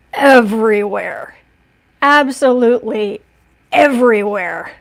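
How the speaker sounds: loud, slow dismay at the mess; drawn-out loud everywhere, exasperated; emphatic everywhere. Real, not acted. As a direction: stretch out everywhere, aghast. Nails spilled everywhere, dismay.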